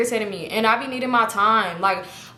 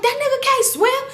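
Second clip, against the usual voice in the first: high, louder alarmed call; imitating the men shouting; mock alarmed shout; 4 dB louder than usual. Men at the pool shouting that he cannot swim, alarmed.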